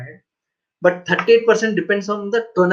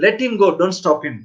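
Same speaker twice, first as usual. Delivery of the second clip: usual speaking voice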